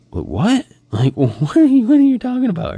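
high, loud mock disbelief; bewildered. Husband retelling wife's confusion at the movie news, bewildered. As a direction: imitate a baffled wife, pitch jumping up.

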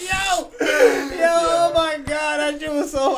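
high, loud squeal through laughter; real helpless laughter. Real, not acted. Cracking up at his own little spoon joke, squealing. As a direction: gasp it out, barely able to talk for laughing.

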